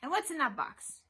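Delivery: high, loud, pointed question; barked demand. A narrator's reading. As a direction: demand an answer officiously. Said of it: "Autolycus barked".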